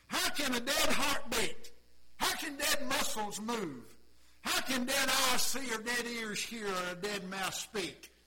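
slow, loud, weighty; preaching rhetorical questions. Acted. Preacher marvelling to the church at the raising. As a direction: pound each question home.

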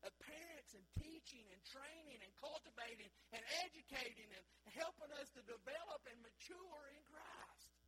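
loud, high, rousing preaching to the church; full force preaching. Acted. Pastor rousing his congregation, loud fervent preaching. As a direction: drive it home from the pulpit, near shouting.

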